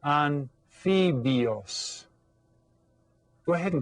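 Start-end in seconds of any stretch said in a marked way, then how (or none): 0.0-2.1 "Anfibios" very slow, an, FEE, byos; model pronunciation. Shown as a demonstration. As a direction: break "Anfibios" into drawn-out Spanish syllables. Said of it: "Can you say that?".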